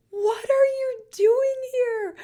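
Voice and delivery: high, slow, stunned squeal of surprise; shocked surprise. Retold or imitated. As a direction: gasp it out, caught totally off guard. Friends surprise her at door.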